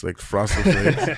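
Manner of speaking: slow, laughing through the words. Real, not acted. To the hosts, laughing at waking up in cereal. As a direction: chuckle it out slowly.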